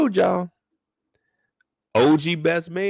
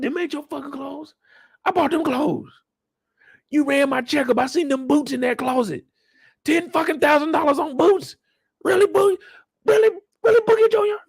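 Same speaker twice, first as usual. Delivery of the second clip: angry father; 13 semitones higher than usual. Retold or imitated. Father yelling back about the clothes, high angry. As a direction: blow up at the son.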